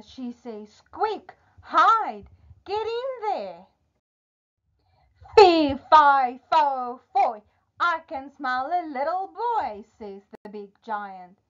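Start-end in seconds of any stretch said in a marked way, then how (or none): 0.9-3.6 high, urgent storybook wife; urgent warning. Acted. Wife hides Jack from her big man, urgent. 5.3-9.8 loud, raised storybook giant chant; booming giant. Acted. Giant comes home smelling a boy, booming.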